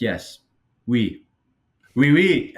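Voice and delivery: hammed-up French yes, building up; mock French oui. Contestant mocking French culture, growing mock French cheer. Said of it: "making fun of".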